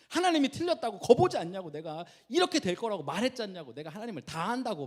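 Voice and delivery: acted-out indignant protest; arguing with God. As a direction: argue back as if proven right. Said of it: "막 하나님 틀렸다고 막 싸워요".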